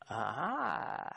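long, stretched "aaah" of discovery; drawn-out knowing aha. Acted. Preacher reacting to the paradox he posed, drawn-out aha. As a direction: draw it out as if the puzzle dawns.

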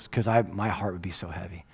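soft unvoiced whisper; whispered confession. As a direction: let the weight of guilt sink in. Heavy-hearted confession to daughter.

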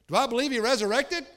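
loud, high rhetorical question; preacher's ringing challenge, rising at the end; incredulous exclamation. Real, not acted. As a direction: cry it out as if the doubt is absurd.

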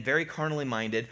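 usual speaking voice

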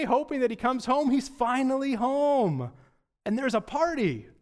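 high, eager, bursting with the news; excited servant. Pretend. Servant telling the older brother, excited and high. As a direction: tell it like the best news of the year.

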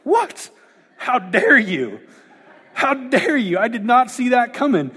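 high indignant shriek then mock outrage; outraged movie brothers. Retelling Step Brothers scene to congregation, hammed-up outrage. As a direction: play the spoiled brother, aghast. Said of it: "and they're like".

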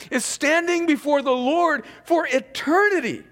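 impassioned preaching. Real, not acted. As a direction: preach it out to the whole room. Preaches at full force.

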